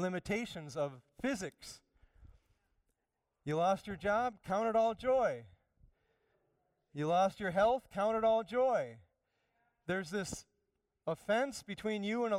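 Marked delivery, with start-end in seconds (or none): 3.4-9.0 high, loud, ringing call; preaching at full force. Real, not acted. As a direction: lift the room with each question and answer. Preaching rhetorical challenges.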